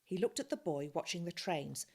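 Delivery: ordinary delivery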